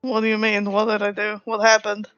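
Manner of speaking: ordinary talk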